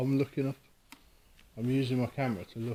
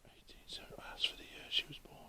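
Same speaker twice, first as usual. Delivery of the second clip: hushed whisper; 17 dB quieter than usual. Real, not acted. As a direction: whisper so nothing is disturbed. Whispering during a ghost hunt.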